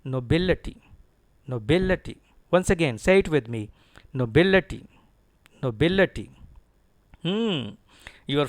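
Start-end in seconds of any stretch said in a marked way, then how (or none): 0.0-2.2 nobility as no-BIL-uh-tee; stress on BIL. Shown as a demonstration. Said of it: "stress is on B I L". 4.1-6.3 nobility with a loud punched BIL; loud model no-BIL-uh-tee for the class; stress on BIL. Shown as a demonstration. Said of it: "stress is on B I L".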